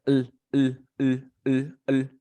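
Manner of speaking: "L" as a short voiced "ul", repeated; L sound. Shown as a demonstration. Teacher showing a student the L, isolated repeated sound. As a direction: sound out each "L" alone, short and even. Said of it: "el sonido de la L".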